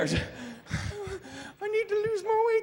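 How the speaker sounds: very high, slow mock whine. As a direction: drag it out, high and pitiful. Mocking skinny jogger.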